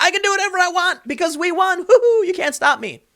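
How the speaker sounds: high, giddy mocking voice; squeaky triumphant gloat; gloating clown. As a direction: taunt like a victorious clown. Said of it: "jumping up and down and dancing and being like".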